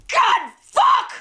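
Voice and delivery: high, loud cry of pain and fury; re-enacted shriek, pitch leaping way up; retold scream. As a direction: scream the curse as if just burnt. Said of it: "so I just screamed".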